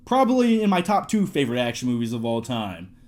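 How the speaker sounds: ordinary talk, nothing marked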